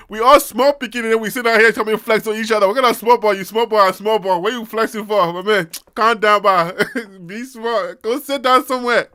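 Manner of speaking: loud, teasing Liberian pidgin; mock-scolding Liberian street talk; Liberian big-boy accent. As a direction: tell off the small boys in a Liberian accent. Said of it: "when you did the small boy, big boy accent".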